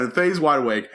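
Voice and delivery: ordinary delivery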